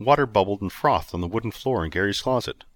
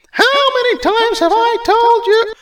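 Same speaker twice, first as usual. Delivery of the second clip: very high, loud, slow nag; nasal twangy scolding; 12 dB louder, slower than usual. Acted. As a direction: scold him like a shrill wife. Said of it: "a nasally, twangy voice yelled".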